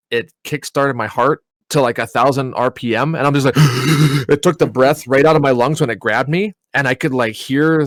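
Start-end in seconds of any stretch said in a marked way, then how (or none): 3.6-4.2 sudden gasp of shock; acted sharp gasp. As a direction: suck in air as if grabbed. Ghost grabs him in retelling.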